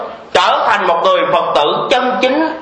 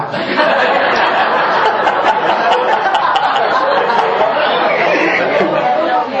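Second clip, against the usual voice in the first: breathy burst of laughter, high up; long unvoiced laugh; airy high laugh; 7 semitones higher than usual. Real, not acted. Laughs at his own story.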